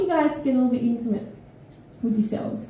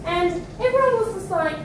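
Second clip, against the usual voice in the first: ordinary delivery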